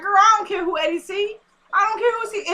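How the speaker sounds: high-pitched sassy mock denial; defensive retort. Retold retort to Esperanza about Eddie, defensive. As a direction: protest too much, voice shooting up. Acted quarrel over Eddie.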